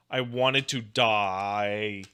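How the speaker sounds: I wanted to die, drawn out very slowly; slow emphatic repeat. Real, not acted. Recalling meme cringe, repeating it very slowly. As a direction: drag every word out for effect. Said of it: "and I wanted to die".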